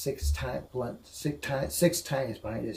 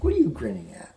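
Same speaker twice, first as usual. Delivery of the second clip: mock surprise; 9 semitones higher, 8 dB louder than usual. Retold teasing question to Lorraine.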